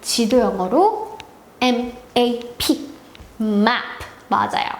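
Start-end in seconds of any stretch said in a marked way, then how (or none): none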